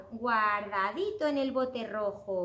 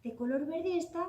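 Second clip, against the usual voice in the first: plain reading voice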